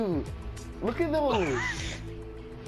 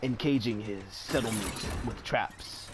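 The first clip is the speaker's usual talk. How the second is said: documentary narrator; 13 semitones lower, 7 dB quieter than usual. Acted.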